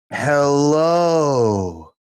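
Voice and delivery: ordinary delivery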